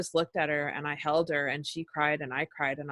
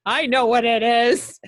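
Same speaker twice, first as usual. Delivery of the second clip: higher, louder and slow, a burst of realisation; emotional breakthrough; 5 semitones higher, 8 dB louder, slower than usual. Retold or imitated. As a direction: cry it out as the release hits. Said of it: "I'm like".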